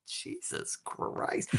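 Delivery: slow, drawn-out disbelieving whisper; whispered exasperation. Retold or imitated. Auditionee's private reaction to the director, exasperated whisper. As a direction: breathe it out slowly, without voice. Muttered exasperated thought, retold.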